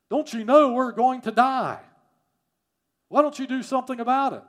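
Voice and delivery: loud, high, frantic outcry. Acted. Disciples waking Jesus in the sinking boat, frantic shouting. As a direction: cry out in hammed-up panic over the storm. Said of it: "and they're frantic".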